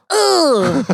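high, exaggerated effortful "ugh"; mimed poking grunt. Acting out the jab in the ribs, grunt. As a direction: grunt as if jabbing hard.

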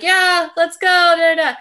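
high, loud put-on voice for the husband; wife imitating her husband's cheerful "let's go", high and loud; mock husband voice. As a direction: ham up the husband's eager reply.

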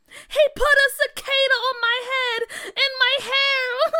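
high, loud crying yelp; upset yelp. Acted. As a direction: wail out the complaint. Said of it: "she yelped".

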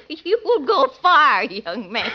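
giggly, laughing through it. Acted. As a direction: laugh while you flatter him. Landlady laughs flirtatiously.

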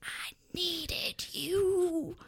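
slow, soft whisper; mock-tender whispered plea; quietly. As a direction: hush it slowly into his eyes. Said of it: "then said quietly".

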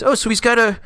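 put-on high, stammering silly British accent; Hugh Grant accent. Father-to-be haltingly asking the doctor, silly British accent. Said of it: "this is me doing a stupid accent".

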